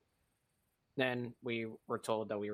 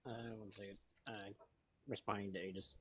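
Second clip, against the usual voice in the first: very soft, near-whispered mumble; too quiet to hear; 10 dB quieter than usual. Real, not acted. Muttering to the squad while busy replying to Aegis, barely audible. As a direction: speak far under your voice, barely audible. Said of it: "could you say it with your chest".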